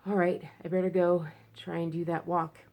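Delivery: usual speaking voice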